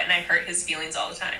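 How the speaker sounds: normal talking voice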